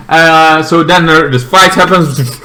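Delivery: ordinary talk, nothing marked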